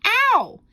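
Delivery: sharp, very high, loud ow; boy yelping in sudden pain. Acted. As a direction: yelp as if stabbed with a pin. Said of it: "and he yelled".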